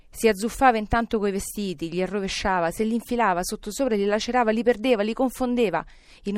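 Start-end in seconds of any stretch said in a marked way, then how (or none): none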